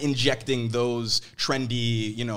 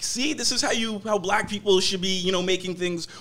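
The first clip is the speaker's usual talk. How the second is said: high-pitched lecturing quote; preachy admirer; 7 semitones higher than usual. Acted. Imitating people who use her as an example, preachy.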